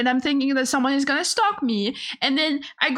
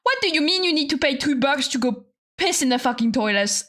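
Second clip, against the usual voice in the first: ordinary talk, nothing marked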